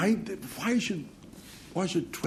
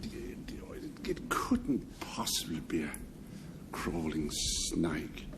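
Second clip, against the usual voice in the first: whisper; 7 semitones lower, 9 dB quieter, much slower than usual. Real, not acted. Teasing whisper doubting the definition.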